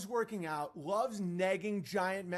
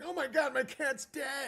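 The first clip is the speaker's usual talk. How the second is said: mock grief; 8 semitones higher, 4 dB louder than usual. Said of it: "it's like".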